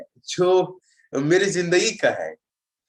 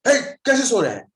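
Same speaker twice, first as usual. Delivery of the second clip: high, louder scolding call; driver's shout; 7 semitones higher, 3 dB louder than usual. Retold or imitated. Bus driver scolding the sleeping passenger, raised shout. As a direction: snap him awake, half-shouting. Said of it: "वो क्यों चिल्ला रहा है".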